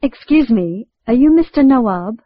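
usual speaking voice

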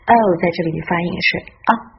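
normal talking voice

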